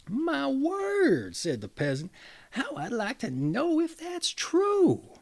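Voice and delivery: high, slow, stunned exclamation; shocked peasant. Acted. Peasant hearing his wife is deceiving him, shocked. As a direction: let the suspicion land, high and drawn out.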